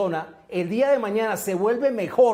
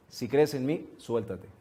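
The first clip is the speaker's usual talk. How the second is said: low, much softer voice of the rescuer; deep calm voice; 9 semitones lower, 8 dB quieter, slower than usual. Retold or imitated. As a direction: drop it low and quiet, a final test. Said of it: "la voz le vuelve y le dice". (voz grave y muy suave, serena)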